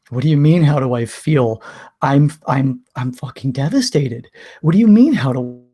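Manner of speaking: retold exasperated cry, loud on "what do you mean"; indignant outburst. Hurt boyfriend answering her question, indignant outburst. Acted reply to girlfriend, devastated outburst.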